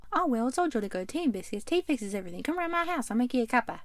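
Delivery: hammed-up British accent sliding into Australian; British turning Australian. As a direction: put on a British accent that drifts Aussie. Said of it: "went from a British accent to an Australian one".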